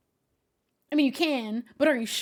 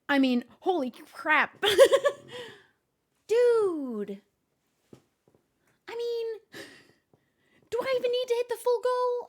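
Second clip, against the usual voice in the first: excited; 11 semitones higher, 7 dB louder than usual. Real, not acted. Overwhelmed by gifted subs.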